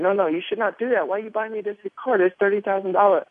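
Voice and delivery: high-pitched mock mom refusing the gift; worried mother. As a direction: play the protesting mother in a high voice. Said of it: "and my mom said".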